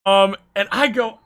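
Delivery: normal talking voice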